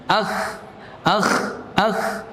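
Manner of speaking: akh said with friction and no hold; wrong breathy kaf. Shown as a demonstration. Teacher showing students the wrong kaf sound. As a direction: let the air rush out as akh. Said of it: "akhirnya berbunyi akh".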